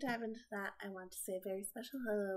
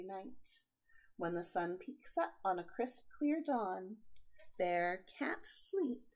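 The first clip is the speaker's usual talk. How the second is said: put-on slow, lilting read-aloud for children; storybook reading; 6 dB louder, slower than usual. A narrator's reading. Aunt reading a picture book to kids, gentle and lilting. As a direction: read it like bedtime for little ones.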